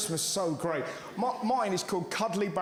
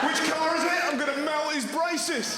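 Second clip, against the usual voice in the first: loud, much higher, gleeful; eager to scare; 7 semitones higher, 3 dB louder than usual. Retold or imitated. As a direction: rub your hands, ready for mischief.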